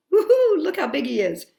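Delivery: loud, quick delight; excited reader gushing over a giant. Real, not acted. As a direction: whoop at the big picture.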